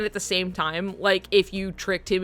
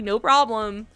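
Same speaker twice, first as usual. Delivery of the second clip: ordinary talk, nothing marked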